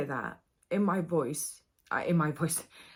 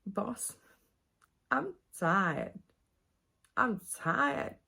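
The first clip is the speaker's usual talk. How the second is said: imitated Southern drawl of a weary prisoner; weary movie quote in a Southern accent; Southern American accent; 3 semitones higher, much slower than usual. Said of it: "the Southern American accent".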